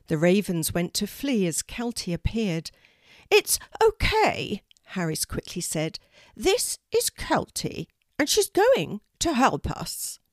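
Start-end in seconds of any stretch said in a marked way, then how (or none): 3.3-4.6 high, slow bird voice; a raven soothing the flock; unusual raven voice. A narrator's reading. 6.4-10.2 a raven soothing the flock; unusual raven voice. A narrator's reading. Raven introduces Kelty.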